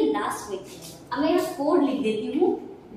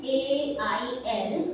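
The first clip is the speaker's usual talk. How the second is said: slow letter-by-letter spelling; much slower than usual. Shown as a demonstration. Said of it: "अब next मैंने लिखा है यहाँ पर टी".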